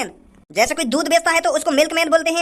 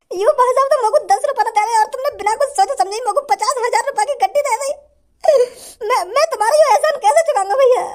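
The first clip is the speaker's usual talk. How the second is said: tearful thanks; 7 semitones higher than usual. Acted. Beggar thanking his rescuer, laughing and crying. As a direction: gush thanks through happy tears.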